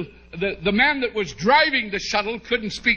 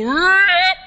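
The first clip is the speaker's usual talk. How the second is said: loud high mock rip sound; tearing cloth noise; 9 semitones higher, 6 dB louder than usual. Squeezing into the tiny car, a loud high mock rip. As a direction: blurt the sound of trousers splitting. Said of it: "I heard something go".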